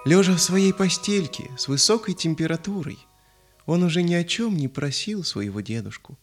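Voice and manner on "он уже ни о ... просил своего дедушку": ordinary delivery, nothing marked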